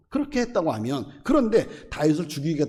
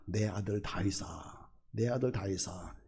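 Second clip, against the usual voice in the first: tender call; 7 semitones lower, 11 dB quieter than usual. Retold or imitated. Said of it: "이렇게 아주 다정하게 부르죠".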